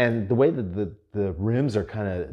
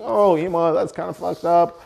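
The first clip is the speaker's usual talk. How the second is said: lightly mocked high shocked listener; imagined listener's objection; 10 semitones higher, 7 dB louder than usual. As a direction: play the tut-tutting audience, high and pearl-clutching. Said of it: "Now you're thinking".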